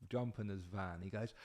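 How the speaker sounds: ordinary delivery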